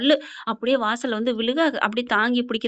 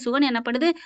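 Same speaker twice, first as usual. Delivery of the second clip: ordinary talk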